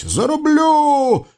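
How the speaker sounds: high, drawn-out war cry; stretched high battle shriek. Acted. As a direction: charge at him yelling. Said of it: "да на воеводу бросилась".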